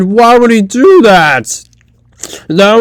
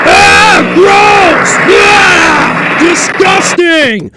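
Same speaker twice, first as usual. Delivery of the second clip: disgusted shout; 8 semitones higher, much slower than usual. Acted. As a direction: shriek in disgust.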